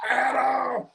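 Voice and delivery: high-pitched shouted name; hollering. Pretend. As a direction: holler the name at the top of your voice. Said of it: "why you hollering?".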